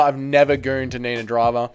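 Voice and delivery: usual speaking voice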